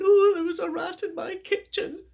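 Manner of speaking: woman telling her friend about a rat; villager's alarm. Acted. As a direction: blurt out the news to a friend.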